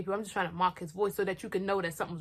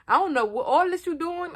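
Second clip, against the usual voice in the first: loud exasperated retort; 8 semitones higher, 6 dB louder than usual. Retold or imitated. Retold to boyfriend, leaving.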